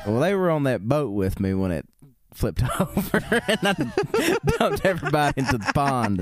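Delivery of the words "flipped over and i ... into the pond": high, helpless laughter breaking through; laughing while talking. Real, not acted. Telling co-host about dumping everyone in the pond, laughing.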